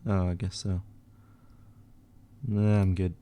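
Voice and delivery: normal talking voice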